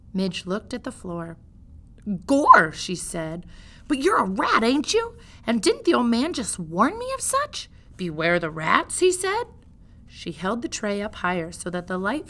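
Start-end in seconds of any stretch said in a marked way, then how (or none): 2.3-2.7 a loud coarse cry of wonder; loud exclamation. Acted. Deaf Midge exclaims loudly. 3.9-9.4 a lightly voiced country girl, loud and higher; a half-deaf girl talking too loud; loud serving girl.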